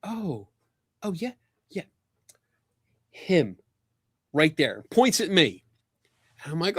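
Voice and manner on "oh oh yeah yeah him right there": lightly voiced photographer pointing someone out; a hint of a hushed tip-off; imitating Paul. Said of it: "I see him go".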